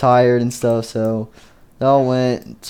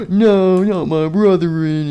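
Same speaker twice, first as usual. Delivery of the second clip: anguished cry; 6 semitones higher, 4 dB louder, slower than usual. Acted. Chica crying out in shock.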